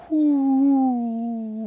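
loud exhale of disbelief; loud whew. Real, not acted. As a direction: blow out a big impressed whew. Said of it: "enough of the noise effects".

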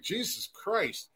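slow, quiet exasperated mutter; exasperated sigh. Retold or imitated. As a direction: drag it out, fed up. Exasperated aside, retold.

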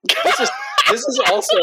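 breaking up with laughter; laughing. Real, not acted. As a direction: talk through a laugh. Laughing while trying to speak.

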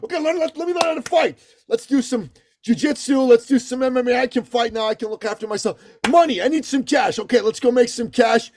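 high, hyped-up imitation of a young gym bro; mocking manosphere bro pep talk, pitched high. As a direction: ham up the self-pumping young guy. Mocking young man's manosphere monologue.

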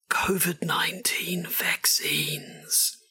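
slow whispered words. Pretend. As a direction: whisper it slowly and secretly. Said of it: "Just be a little bit subtle there".

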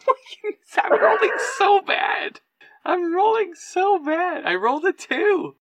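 loud falsetto whine over bad luck; high-pitched wail. Real, not acted. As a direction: wail it way up high, loudly bemoaning the roll. Exasperated at a terrible dice roll.

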